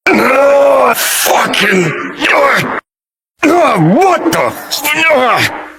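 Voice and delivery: high-pitched cries of agony; agonized. Acted. Someone in agony as if head crushed in a vice, agonized. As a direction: groan and yell through splitting head pain.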